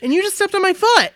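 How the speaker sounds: high-pitched mock annoyance; pissed off. Woman imagines the star pissed off about his foot, mock annoyed. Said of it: "could have been pissed off".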